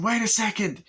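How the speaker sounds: high, startled interjection; a hammed-up startled cop; alarmed cop.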